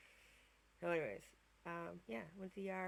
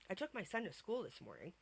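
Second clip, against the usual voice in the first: ordinary talk, nothing marked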